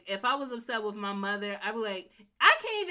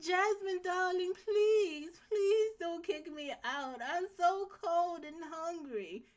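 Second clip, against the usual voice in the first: put-on much higher, slow and pleading like a frail old lady; feeble old mother; 9 semitones higher, slower than usual. Jasmine's old mother begging her not to kick her out, in a high feeble voice. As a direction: beg like a helpless old mother. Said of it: "made her sound so feeble".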